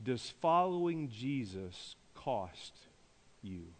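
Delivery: low, slow, softened question; soft, weighty question. Real, not acted. Preacher puts a searching question to congregation, softly and slowly. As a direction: pose it slowly and let it land.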